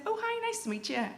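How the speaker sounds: very high, quick social voice; polite greeting. Retold or imitated. Meeting an older stranger at the festival, politely high-pitched.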